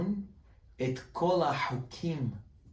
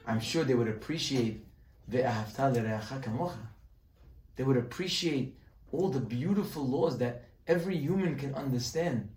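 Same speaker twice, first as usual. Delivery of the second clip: ordinary talk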